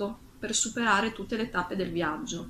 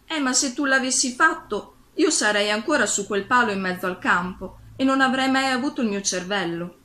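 raised, louder storybook exclamation; exclaiming; 5 semitones higher, 6 dB louder than usual. A narrator's reading. Scarecrow answers Dorothy about his brain, exclaiming. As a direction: exclaim it in a higher, louder reading voice. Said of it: "esclamò lo spaventapasseri".

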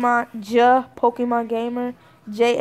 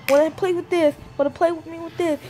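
high, rushed little-sister chatter; imitating pestering sister; 7 semitones higher, 3 dB louder, faster than usual. Sister begging brother's friends to play, mock eager. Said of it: "she has to go look at them, talk to me".

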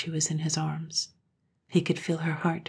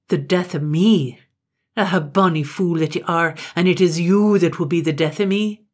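louder scornful taunt; mocking retort; 8 dB louder than usual. A narrator's reading. Gloom mocking Katrine's threat. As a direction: throw her threat back with scorn.

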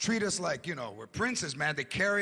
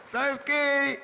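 put-on drunk declaration; 2 semitones higher than usual. Drunk boyfriend telling girlfriend he loves her, slurring. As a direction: pour out love like a sloppy drunk.

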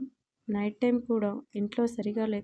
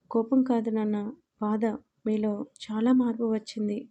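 ordinary delivery

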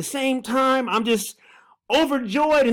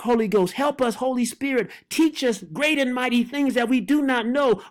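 usual speaking voice

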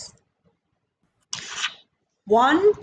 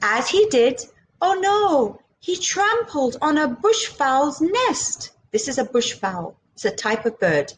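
ordinary delivery

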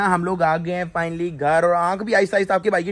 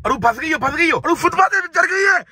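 mock panicked alarm; 10 semitones higher than usual. Car climbs footpath, panic.

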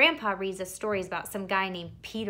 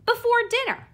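high, louder squeal on the ending; excited blurt; 14 semitones higher, 6 dB louder than usual. Acted. Boy blurting to parents about ice cream, excited. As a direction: let it burst out too happily.

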